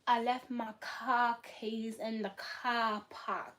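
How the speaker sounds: slow mock Boston accent, Rs dropped. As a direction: imitate a Bostonian, slowly, broad "cah" and "pahk". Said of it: "I don't have a Boston accent".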